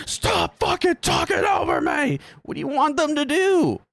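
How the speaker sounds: very high-pitched, hammed-up shouting; mock furious yelling. Reactor mocking an entitled customer's outburst, high exaggerated yell. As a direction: yell it like a customer having a tantrum. Mocks ranting customer, argues back.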